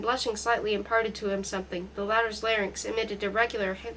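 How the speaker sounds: ordinary delivery, nothing marked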